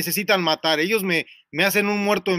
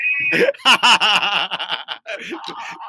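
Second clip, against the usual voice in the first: big rising whoop of laughter. Real, not acted. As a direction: let the laugh explode. Big laugh after boast.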